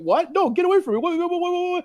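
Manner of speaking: squeaky high panicked what-what-what; mock scared kid. Bully backing away, squealing in a high mock panic. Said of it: "they'd be like".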